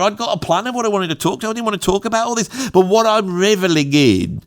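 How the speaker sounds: usual speaking voice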